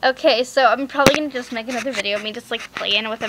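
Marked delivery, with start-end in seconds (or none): none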